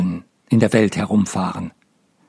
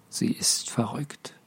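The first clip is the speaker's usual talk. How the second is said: whisper; 2 semitones higher, 11 dB quieter, slower than usual. Acted. Unspoken look between men.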